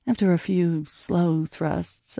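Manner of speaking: plain reading voice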